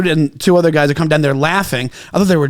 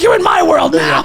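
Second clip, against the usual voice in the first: high shouted villain line; mock villain shout; 9 semitones higher than usual. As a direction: bellow it like a movie baddie. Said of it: "I'm like".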